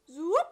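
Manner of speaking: rising loud zoop; a quick swooping magic sound. A narrator's reading. Magic wand sound effect.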